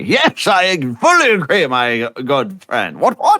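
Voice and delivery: hammed-up posh British gent, high and loud; a bad British accent, piping up cheerfully; overdone British accent, rising to a squeak; terrible British accent. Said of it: "I do a terrible British accent".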